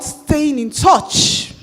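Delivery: "Staying in touch" stretched very slow, each word leaned on; slow emphatic answer. Shown as a demonstration. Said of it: "by doing what?".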